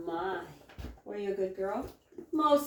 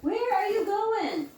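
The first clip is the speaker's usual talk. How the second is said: higher and slow, drawn-out question; slow high pet talk; 10 semitones higher, slower than usual. Real, not acted. To a wandering chicken, slow high coaxing. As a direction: ask the wandering hen sweetly and slowly.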